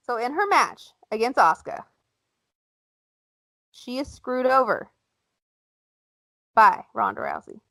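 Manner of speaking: normal talking voice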